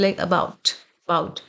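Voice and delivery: 'about' with a very quick, unstressed first vowel; quick schwa. Shown as a demonstration. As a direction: skim over the opening A of 'about'. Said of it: "इसे बहुत fast read किया जाता है".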